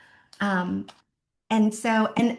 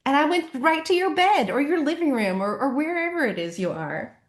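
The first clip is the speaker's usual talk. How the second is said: gushing fan post; 6 semitones higher than usual. Retold or imitated. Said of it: "post it and be like".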